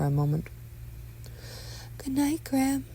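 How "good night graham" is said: tender mother. Acted. Mother says good night to son, high tender voice. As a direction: pitch it up gently.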